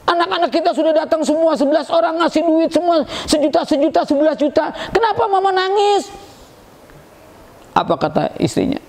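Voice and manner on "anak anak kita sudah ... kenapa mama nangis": lightly voiced husband, pitched high; high-pitched mock questioning; acted husband questioning. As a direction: imitate a husband pressing his wife with questions. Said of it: "Kata suaminya".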